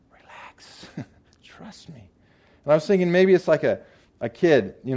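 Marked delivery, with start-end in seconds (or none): 0.1-2.1 Lord's whisper. Acted. The Lord soothing the anxious speaker.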